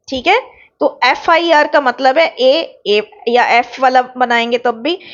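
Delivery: normal talking voice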